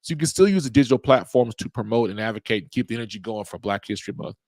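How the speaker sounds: ordinary talk, nothing marked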